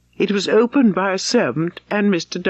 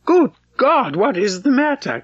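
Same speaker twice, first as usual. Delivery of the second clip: startled, higher outburst; alarmed cry; 7 semitones higher than usual. A narrator's reading. Darcy alarmed at Elizabeth's pale face, crying out. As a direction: cry out with more feeling than politeness. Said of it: "cried he, with more feeling than politeness".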